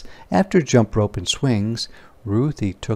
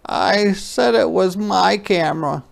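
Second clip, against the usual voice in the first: tearful confession; 8 semitones higher than usual. Acted. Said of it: "cried Ruthie".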